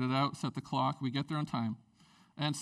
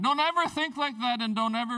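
higher, pressing appeal; urgent plea; 6 semitones higher, 4 dB louder than usual. Real, not acted. Pastor warning his church, urgent plea. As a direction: drive the warning home. Preacher urging congregation.

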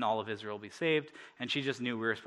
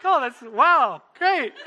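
high, loud, laughing amazement; hammed-up excitement; 13 semitones higher, 12 dB louder, much slower than usual. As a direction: replay your delight way over the top. Comic replay of his delighted reaction.

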